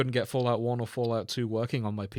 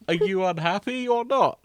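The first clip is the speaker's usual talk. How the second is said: higher, louder, laughing tease; mock exasperation; 8 semitones higher, 8 dB louder than usual. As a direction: pretend to be fed up. Mock exasperated teasing cohost.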